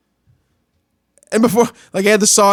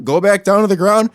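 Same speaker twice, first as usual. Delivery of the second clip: usual speaking voice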